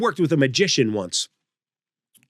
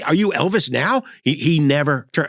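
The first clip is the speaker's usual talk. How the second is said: ordinary delivery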